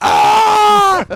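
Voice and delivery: very loud, high-pitched scream; mock pain scream. Imitating the hurt wrestler in the clip, loud high scream. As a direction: howl like a hammed-up injured wrestler.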